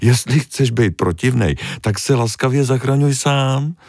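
building from stern to high indignant; offended scolding. Acted. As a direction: scold him and turn away in a huff. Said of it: "Malá ryba se zamračila".

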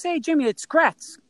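high-pitched acted hello; hearty phone greeting. Star player phoning a young fan, acted hearty greeting. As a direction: announce yourself brightly, imitating a famous caller.

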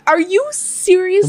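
can't believe he ate the fries; indignant disbelief. Retold or imitated. Wife retelling catching husband eating her fries, indignant. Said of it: "And I'm like".

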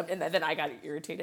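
normal talking voice

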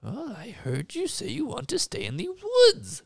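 high, grand voice of the woods' master; mystical forest master. Acted. The master of the woods greeting a lonely man in a high grand voice. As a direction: announce yourself as lord of the forest.

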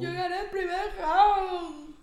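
slow, high blubbering; hammed-up tearful brag; mock crying boxer. (imitando llanto de boxeador)

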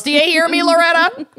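high, loud calling out. Retold or imitated. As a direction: call out across the room. Repeating the movie quote.

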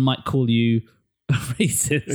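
amused, drawn out through a laugh. Real, not acted. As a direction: laugh breaking into the words. Laughs while naming the accusation.

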